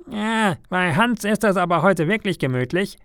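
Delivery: a small bird sighing with envy at the window; bird thinking enviously. A narrator's reading.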